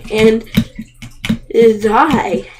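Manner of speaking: ordinary talk, nothing marked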